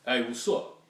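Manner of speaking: loud, blunt guy greeting; mock a manly hello, no sweetness at all; imitating a man's loud "what's up"; talking like a man. Shown as a demonstration. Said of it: "talk to me like a man".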